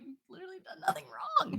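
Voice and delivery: soft reassuring inner whisper; whispered self-talk. Retold or imitated. Speaker calming her own guilt, whispering to herself. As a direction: whisper it to yourself. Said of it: "I'm like".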